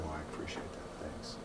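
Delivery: whispered, grateful thanks. Retold or imitated. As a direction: breathe the thanks softly. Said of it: "I said".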